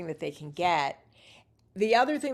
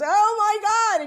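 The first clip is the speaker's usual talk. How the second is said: a much higher, louder Oh, my God; surprised laughing exclamation; 10 dB louder than usual. Retold or imitated. Angela realising the newcomer was joking, delighted shock.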